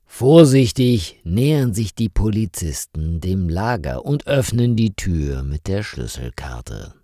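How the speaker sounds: plain reading voice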